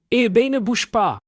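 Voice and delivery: high-pitched urgent shout. A narrator's reading. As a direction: yell a warning. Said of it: "Samuel crie".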